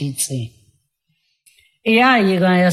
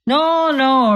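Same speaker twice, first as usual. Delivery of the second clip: angrily; 5 semitones higher, 3 dB louder than usual. Acted. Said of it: "replied the other angrily".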